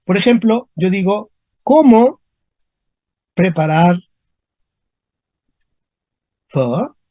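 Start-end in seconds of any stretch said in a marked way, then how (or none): 1.6-7.0 one word at a time, stopping before "preparar" and "pho"; spaced-out example, "¿Cómo ... preparar ... pho?"; dictating. Shown as a demonstration. Teacher dictates example question in pieces.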